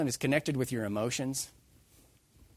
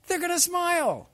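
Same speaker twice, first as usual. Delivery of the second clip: exclaimed; 8 dB louder than usual. Real, not acted. Preacher builds to a punchline.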